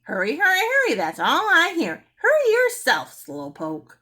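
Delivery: loud, rising to a very high squawk; a parrot sassing the mother. A narrator's reading. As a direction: squawk back indignantly. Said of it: "Penelope squawked".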